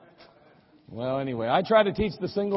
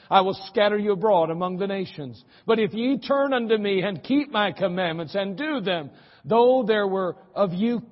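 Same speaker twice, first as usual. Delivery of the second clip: fervent scripture prayer; 3 semitones higher, 4 dB louder than usual. Acted. Pastor reading Nehemiah's prayer to the church, impassioned. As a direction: plead the prayer with fervour.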